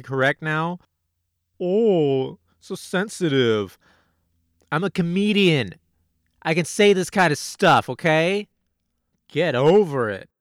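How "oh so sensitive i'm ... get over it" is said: louder, indignant mock defiance; defensive comic. Comedian scolding a touchy audience, louder and indignant. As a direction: push back at the audience, huffy.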